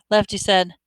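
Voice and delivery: ordinary talk, nothing marked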